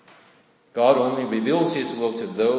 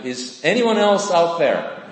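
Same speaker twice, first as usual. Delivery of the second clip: higher, louder call out; shouting for help; 6 semitones higher, 5 dB louder than usual. A narrator's reading. Man refuses to let go, shouts for anyone else. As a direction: yell past God for someone else. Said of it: "began to shout again".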